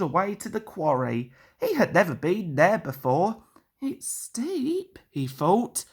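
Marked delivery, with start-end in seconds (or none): 3.8-5.0 put-on high, light young engine voice; a cocky small engine sizing up the hill; Percy the little engine. A narrator's reading. Percy's voice in story.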